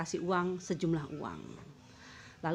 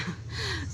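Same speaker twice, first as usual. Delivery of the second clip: high laugh; 12 semitones higher, 4 dB quieter than usual. Real, not acted. Laugh while telling story.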